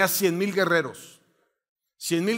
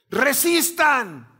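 slow, drawn-out order, higher and a bit louder; commander's order; 6 semitones higher, 5 dB louder, much slower than usual. Acted. Spartan leader to his soldiers, slow drawn commanding call. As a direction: hold the line, stretch every syllable.

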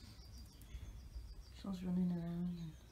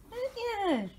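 pet talk squeak; 8 dB louder than usual. Real, not acted. High pet talk to the animal.

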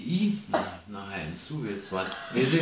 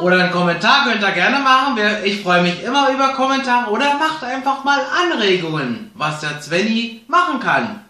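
bright, louder sign-off to viewers; vlog outro; 6 semitones higher, 6 dB louder than usual. Acted. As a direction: address your YouTube audience. Video-Abschied an Zuschauer. (fröhliche Verabschiedung ans Publikum)

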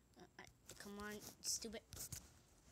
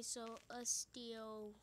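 normal talking voice

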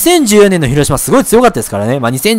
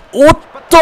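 alarmed cry; 10 semitones higher, 3 dB louder than usual. Real, not acted. Player watching the ball get through, alarmed cry. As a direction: yelp as it slips away. Ball gets past fielders.

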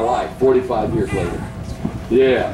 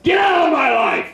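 high, shouted renunciation, much higher; shouted prayer. Real, not acted. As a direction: cast it out at the top of the voice.

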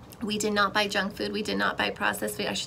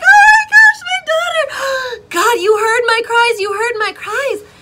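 much higher and louder, near-shouting joy; tearful joyful shout; overjoyed cry; 13 dB louder than usual. Retold or imitated. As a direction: burst out in tearful thanks to God.

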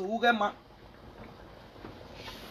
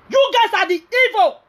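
angry; 9 semitones higher, 4 dB louder, slower than usual. Real, not acted. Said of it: "I'm really angry and I'm annoyed".

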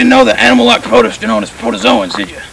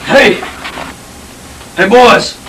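calling out; slower than usual. Acted. Calling out to the boys.

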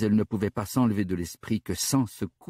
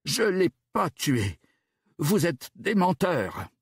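high, slow enraged cry; enraged yell; 8 semitones higher, slower than usual. Acted. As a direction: hurl the accusation, much higher and slower. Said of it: "Enragée". (cri enragé, aigu et lent)

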